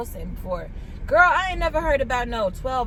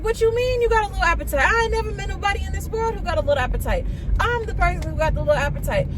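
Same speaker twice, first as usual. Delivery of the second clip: excited disbelief; 7 semitones higher, faster than usual. Retold or imitated. Disbelief retelling her answer.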